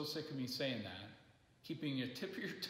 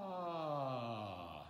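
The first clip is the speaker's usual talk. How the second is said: smooth yawn sigh. Shown as a demonstration. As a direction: slide down without a break. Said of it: "You couldn't even hear my break".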